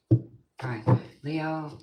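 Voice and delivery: usual speaking voice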